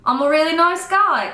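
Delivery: playful fake British voice; British accent. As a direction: put on a mock British charmer. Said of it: "'cause he has a British accent".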